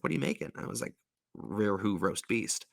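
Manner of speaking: normal talking voice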